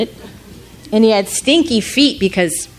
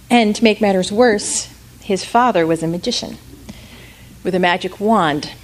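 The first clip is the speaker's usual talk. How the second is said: ordinary delivery, nothing marked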